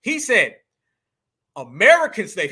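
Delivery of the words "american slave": "American" punched loud and heavy; stress on American. Retold or imitated. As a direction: lean hard on "American", let "slave" follow. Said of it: "He put the emphasis on American".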